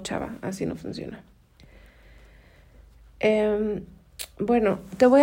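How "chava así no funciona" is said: whispered aside. Real, not acted. Scolding aside to viewer.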